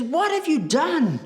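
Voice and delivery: high, slow, outraged cry; angry Moses. Acted. Moses confronting the people at the golden cow, slow outraged cry. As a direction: Moses aghast at his people, high and drawn out. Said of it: "he gets angry and he says".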